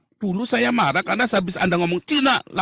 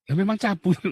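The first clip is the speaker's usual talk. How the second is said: mocking through laughter; laughing; 2 semitones higher than usual. Real, not acted. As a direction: chuckle while taunting. (sambil tertawa mengejek)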